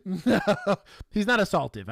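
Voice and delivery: laughing protest. Real, not acted. Laughing after joke.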